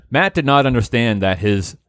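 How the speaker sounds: usual speaking voice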